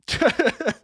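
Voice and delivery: high-pitched burst of laughter; panicky laugh. Real, not acted. Streamer running out of quiz time, panicky laugh. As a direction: let out a high, nervous laugh.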